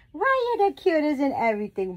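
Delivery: put-on high, loud gushing to a baby; cooing baby talk. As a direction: gush over an adorable baby.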